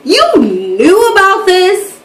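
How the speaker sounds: high, slow, stretched-out disbelief; retold shock, pitch swooping high; incredulous. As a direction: draw out a stunned question. Retold shock at friend's admission.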